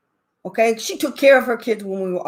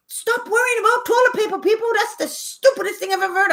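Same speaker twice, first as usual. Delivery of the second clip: exasperated rant; 11 semitones higher than usual. Real, not acted. Ranting at toilet paper hoarders.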